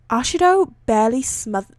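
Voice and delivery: usual speaking voice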